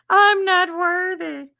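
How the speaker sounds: high, slow, drawn-out bow of mock humility; mock grovelling. Streamer grovelling to chat in a high, slow mock-humble voice. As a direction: grovel theatrically like a humble servant.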